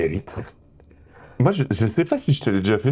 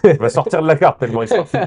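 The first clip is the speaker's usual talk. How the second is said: excited; 4 semitones higher, 6 dB louder than usual. Real, not acted. Hyped about shiny card.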